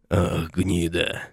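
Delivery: slow groan from the dirt. A narrator's reading. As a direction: moan it out, beaten down. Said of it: "простонал в пыли поверженный десятник".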